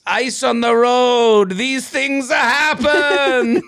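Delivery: put-on old man telling police, high and slow. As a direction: imitate the sweet old man, laughing. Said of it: "He kept saying to the police".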